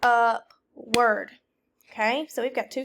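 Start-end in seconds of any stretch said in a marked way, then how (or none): 0.0-1.3 louder, each syllable clapped apart; syllable-by-syllable clapping. Shown as a demonstration. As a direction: say it in claps, up-ward. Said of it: "Clap it".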